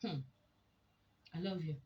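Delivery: perfunctory declaration after a short hum; so-what, let's-move-on delivery; dutiful, grudging. Acted. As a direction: say it just to get it over with. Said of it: "last one sounds like".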